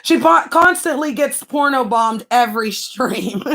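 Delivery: ordinary delivery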